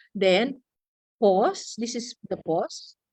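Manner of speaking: ordinary talk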